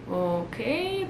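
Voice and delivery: very soft, drawn-out okay; hushed, retold hesitation; taken-aback okay. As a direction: say it small and wary. Retold wary reaction to being cut off.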